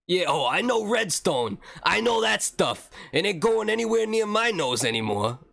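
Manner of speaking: Brooklyn Mario. Acted. Streamer boasts to chat about redstone, mock Brooklyn accent. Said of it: "did that like Brooklyn Mario".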